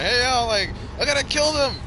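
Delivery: loud, high hammed-up threat; mock hostage-taker shout. Hostage-taker threatening police in a gag, mock shout. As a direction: yell it like a comic hostage-taker.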